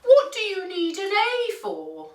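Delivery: high storybook chorus voice; farm animals' question. A narrator's reading. Farm animals asking the duck why, high character voice. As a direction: ask it pitched right up, puzzled.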